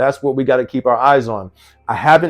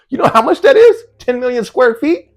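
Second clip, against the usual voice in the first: astonished; 12 semitones higher, 4 dB louder than usual. Real, not acted. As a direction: voice jumps very high in disbelief. Shocked at ten million square feet.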